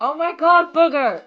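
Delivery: loud high squeal; excited shriek. Real, not acted. Shrieking at the pet.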